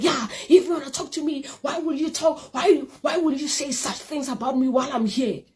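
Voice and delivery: high, half-hearted imitation of a furious girl; mock angry girl. Retelling the angry girl confronting her, mock fury. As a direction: mimic her accusing tone lightly. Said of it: "She was like".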